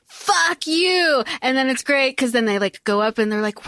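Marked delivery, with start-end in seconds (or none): 0.1-1.2 viewers ranting at the Capitol, mock fury; outraged Capitol crowd. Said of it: "everybody is like".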